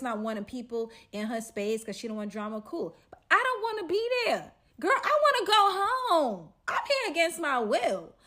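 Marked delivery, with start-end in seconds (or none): 3.1-8.1 high, loud, exasperated complaint; exasperated whining. Retold or imitated. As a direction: whine it loud like you were dragged there against your will. Annoyed, dragged along against her will.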